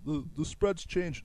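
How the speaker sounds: slow whiny mock of a fan; slow sarcastic mimic of a fan. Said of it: "a fan told us".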